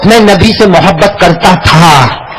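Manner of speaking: demo line. Shown as a demonstration. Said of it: "یوں کہو،".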